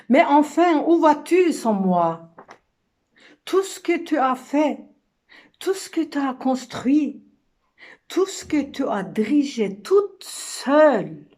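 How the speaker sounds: cri aigu et fort, théâtral; reproche désespéré. Acted. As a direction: rappeler à pleine voix celui qui s'en va. La femme rappelle l'homme qui part. (appel éperdu de femme, joué)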